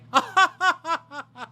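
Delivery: very high posh titter; hammed-up snooty giggle; a pretend millionaire's laugh; fake rich laugh. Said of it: "I'm working on my rich laugh".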